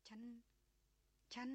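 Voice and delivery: choked up. A narrator's reading. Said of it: "หล่อนมีอาการอัดอั้น".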